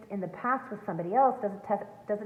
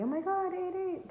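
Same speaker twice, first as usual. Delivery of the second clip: high, contained imitation of screaming; imitated excited screaming; 11 semitones higher than usual. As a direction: squeal it like excited viewers spotting something. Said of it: "screaming at me".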